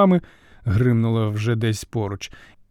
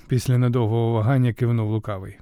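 usual speaking voice

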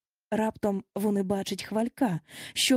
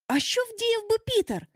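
put-on lightly raised boyish voice; boys asking together; 11 semitones higher than usual. A narrator's reading. Lost boys asking what Peter would do, lightly voiced. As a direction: ask it as a group of boys. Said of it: "запитали всі разом".

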